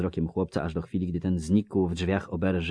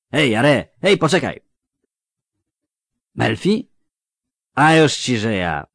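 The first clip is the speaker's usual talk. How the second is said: loud, higher, slurring tipsy villager; drunk Melfi; 6 semitones higher, 11 dB louder than usual. Acted. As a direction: call out like a cheery drunk. Drunk Melfi greets Jare. (pijany Melfi, głośno i bełkotliwie)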